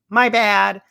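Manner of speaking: high, loud, flippant admission; hammed-up, unbothered oops; mock apology. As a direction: toss out a sing-out fake apology. Voicing a character's flippant admission.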